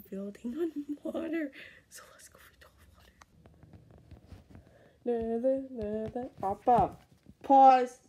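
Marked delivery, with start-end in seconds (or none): 1.9-3.1 quick breathy hush to self; fast whisper. Real, not acted. Kid playing a game whispers the next plan fast. 6.4-8.0 shouted command, much louder; loud yell. Real, not acted. Kid yells pause during the pause challenge.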